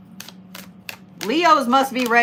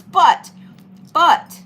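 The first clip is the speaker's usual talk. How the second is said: loud but; 5 semitones higher, 7 dB louder than usual. Real, not acted. Emphatic interjection.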